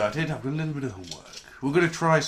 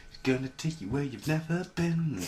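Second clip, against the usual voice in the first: ordinary talk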